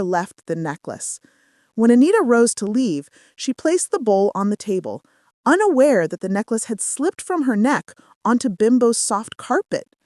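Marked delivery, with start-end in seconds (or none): none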